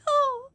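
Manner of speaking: very high melting aww; awe sound. Acted. Queen meeting her nephew, a very high tender aww. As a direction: coo like seeing a baby. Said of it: "make an awe sound".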